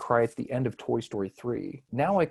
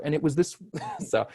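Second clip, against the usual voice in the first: ordinary talk, nothing marked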